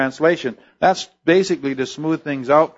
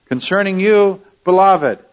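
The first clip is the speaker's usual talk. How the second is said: fond address; 7 semitones higher, 5 dB louder than usual. Acted. Apostle addressing his readers.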